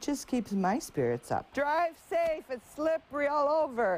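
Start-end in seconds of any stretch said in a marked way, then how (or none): 1.5-4.0 called-out warning. Real, not acted. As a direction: shout a caring warning to the cars. Calling warnings to passing drivers.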